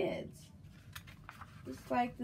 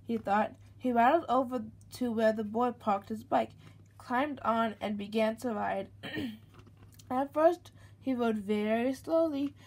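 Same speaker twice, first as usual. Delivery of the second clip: lively, louder storybook read-aloud; reading to a child; 6 dB louder than usual. A narrator's reading. Mother reading a picture book to her baby, lively storybook voice. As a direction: read it out brightly for the little one.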